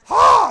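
sudden high, loud "Ha!"; loud laugh burst. Real, not acted. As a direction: bark out one big laugh. Laughing at waxing pain.

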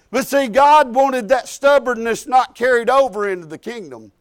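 ordinary talk, nothing marked

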